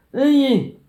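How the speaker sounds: high, loud shout at a stranger; mimicked yelling. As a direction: holler to grab someone's attention. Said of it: "that's how much you were yelling".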